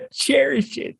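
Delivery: high squeaky "cherish it"; high mock quote. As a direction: squeak the line. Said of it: "quote echo".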